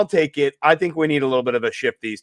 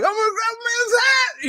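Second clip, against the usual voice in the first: much higher put-on voice for a bratty sitcom brother; a brother in a terrible sitcom announcing his plan, in a high mock voice. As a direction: squeak it out like a cartoonish kid brother. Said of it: "He's like".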